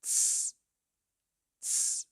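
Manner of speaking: Tz, tz as a sharp unvoiced ts sound; sounding out a letter. Shown as a demonstration. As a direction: isolate the German Z as a crisp ts. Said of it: "beginning with a Z".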